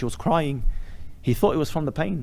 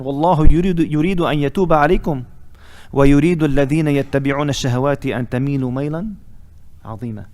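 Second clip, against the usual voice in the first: forceful Arabic verse recitation, dropping at the close; Quran recitation; 5 dB louder than usual. Acted. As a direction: recite the verse firmly, as in a sermon.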